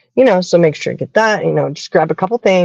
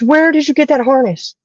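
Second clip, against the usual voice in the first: curious stranger; 8 semitones higher than usual. Retold or imitated. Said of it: "She goes".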